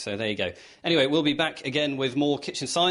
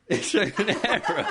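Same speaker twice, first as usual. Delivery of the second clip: echoing the words through laughter; giggling repeat; laughing; 7 semitones higher than usual. Real, not acted.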